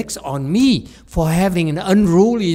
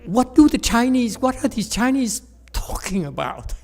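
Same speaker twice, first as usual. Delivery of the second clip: exasperated; 8 semitones higher than usual. Retold or imitated. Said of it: "one day I got so exasperated".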